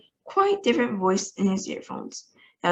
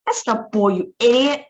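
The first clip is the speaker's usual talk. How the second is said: louder, furious shout of insult; angry shout; 4 semitones higher, 6 dB louder than usual. A narrator's reading. Ground control berating the astronaut, shouting angrily. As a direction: yell at the fool who missed it. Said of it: "shouted ground control".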